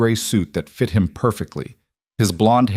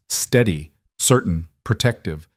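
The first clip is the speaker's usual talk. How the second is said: plain reading voice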